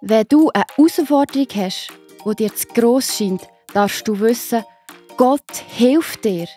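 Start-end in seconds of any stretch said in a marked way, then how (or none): none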